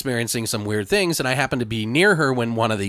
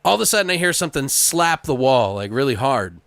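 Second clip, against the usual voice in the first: ordinary delivery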